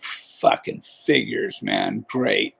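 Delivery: slow whispered grumble under the breath; low, soft, whispered sigh of a complaint; muttered aside. Retold or imitated. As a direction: mutter it to yourself, resigned. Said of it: "I'm like".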